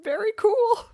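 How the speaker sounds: high, loud, sobbing fake cheer; mock crying. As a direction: force cheer while pretending to cry. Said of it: "fully teary-eyed".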